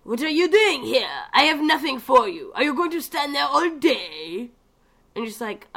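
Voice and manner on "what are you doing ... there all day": higher, lightly faked French accent; a hint of a bad French accent, higher up; fake French barkeep. Imitating the game barkeep's bad French accent, grumpy. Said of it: "he's talking about".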